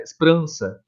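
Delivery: "Esperança" said as s-prança; "Esperança" run together; clipped sprança. Shown as a demonstration. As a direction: drop the first e of "Esperança". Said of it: "eu não falo esperança nesse caso".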